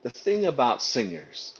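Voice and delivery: slow, measured pronouncement. Retold or imitated. Audition boss delivers his verdict to the singer, slowly. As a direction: draw it out word by word. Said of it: "saying this so slowly".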